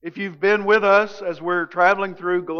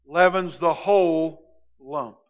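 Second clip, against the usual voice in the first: measured solemn reading of the text; scripture reading. Acted. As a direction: read the passage with reverence. Reading the scripture passage aloud.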